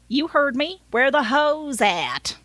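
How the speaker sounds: insistent Southern driver, stretching the question; Southern accent. Acted. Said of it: "I gave him a Southern accent".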